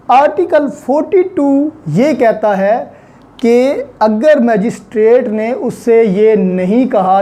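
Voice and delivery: ordinary delivery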